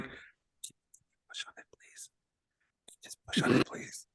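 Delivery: very soft, urgent whisper; subtle plea. Retold or imitated. Rider discreetly begging the ride employee, in a hushed whisper. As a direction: whisper it so nobody else hears. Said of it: "trying to be as, as subtle as possible".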